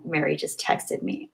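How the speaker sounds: breathless hushed whisper; excited whisper. Real, not acted. Streamer whispering excitedly to her chat. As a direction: whisper it like a thrilling secret.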